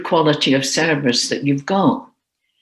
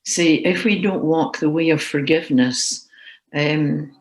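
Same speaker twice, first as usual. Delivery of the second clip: usual speaking voice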